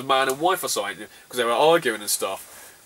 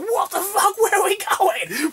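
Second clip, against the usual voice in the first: alarmed shout; slower than usual. Retold or imitated. Passengers shouting as the train suddenly reverses. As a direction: shout up high, bewildered and alarmed.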